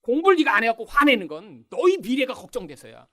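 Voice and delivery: high, loud mock scolding; angry mother. Mother excusing her temper to children over studying, acted angry scolding. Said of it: "엄마가 꼭 화를 내시면서".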